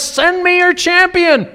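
loud, high battlefield shout; booming giant's taunt, pitched high and loud; crying out a challenge. Acted. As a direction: bellow it across the valley to an army. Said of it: "he cries out to the armies".

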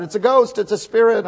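high, loud panicked yell; frightened cry. Retold or imitated. Disciples in the boat cry out, loud and high. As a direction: cry out as a scared disciple in the storm. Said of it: "And so they're crying out".

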